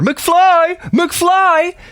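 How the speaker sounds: high, loud Biff-style taunting McFly; McFly call. Pretend. Inner voice snapping him out of his emotions, loud and high. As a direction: bark it like the bully from Back to the Future.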